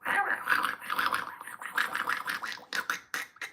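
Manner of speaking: long wordless sputtering duck quack; bad Donald Duck. Shown as a demonstration. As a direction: botch a Donald Duck voice without words. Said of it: "I don't have a good Donald Duck voice".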